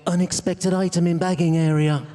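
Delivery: loud, monotone checkout announcement; self-checkout machine. Acted. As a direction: mimic an automated supermarket till. Mimics supermarket checkout machine as punchline.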